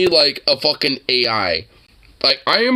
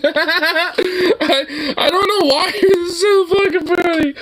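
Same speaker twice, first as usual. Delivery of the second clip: high-pitched, laughing through the words; real laughing fit; 13 semitones higher, slower than usual. Real, not acted. Viewer cracking up at a funny video, laughing. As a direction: barely get it out for laughing.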